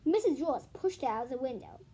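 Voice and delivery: plain reading voice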